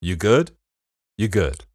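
Detroit accent, easy check-in You good; Detroit greeting. Shown as a demonstration. Showing his cohost the Detroit way, Detroit accent. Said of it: "I say like a Detroiter".

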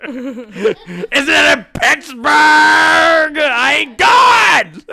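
very loud, high, drawn-out mock holler; over-the-top imitation of a wailing tantrum; mock wailing protest. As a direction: bellow it slowly, imitating her refusal. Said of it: "That's what she said".

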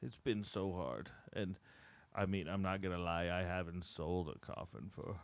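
quiet and sad. Acted. Sad old salesman confiding.

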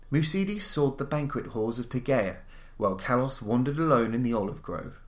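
ordinary delivery, nothing marked

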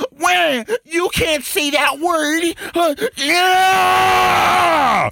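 high, drawn-out wailing proclamation; mock activist outcry. Parodying empty activism for co-hosts, hammed-up wail. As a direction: shriek it like a hammed-up crusader.

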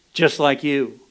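ordinary talk, nothing marked

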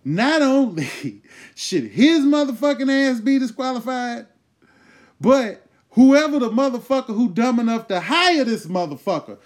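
shouting high and loud, laughing in the middle; full-throated comic outrage; rising outraged rant. Real, not acted. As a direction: blow up at the whole setup. Ranting, building to outrage.

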